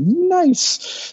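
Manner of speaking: very high, drawn-out gloat; mock delighted cheer. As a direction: savour a lucky windfall in a high voice. Acts out delight at sale price.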